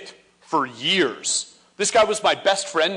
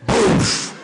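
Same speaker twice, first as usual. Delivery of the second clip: whoosh of fire; 3 semitones higher, 5 dB louder than usual. Retold or imitated. Flame igniting.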